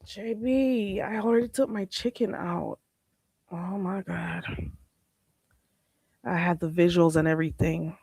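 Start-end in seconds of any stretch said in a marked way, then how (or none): none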